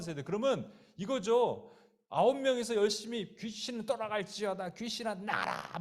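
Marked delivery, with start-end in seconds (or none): 3.4-5.8 high, strained imitation of shouting; hammed-up yell without full volume; mock exorcism yelling. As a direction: mimic disciples straining to cast out a demon. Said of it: "막 소리 지르면서".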